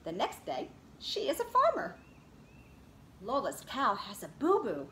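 lively read-aloud picture book voice; reading to children. Acted. Teacher reads Lola Loves Stories to her class, in a lively storybook voice. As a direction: read it to a circle of little kids.

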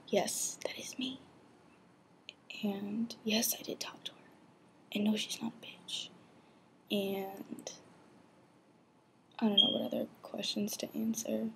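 secretive whispered confession; whispering. Real, not acted. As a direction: lean in and whisper it.

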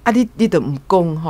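ordinary talk

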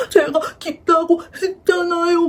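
high, slow mock crying wail; hammed-up weepy whine, drawn out; imitating tearful protest. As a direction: act out sobbing while protesting. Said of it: "막 이러면서 제가 막 울었어요".

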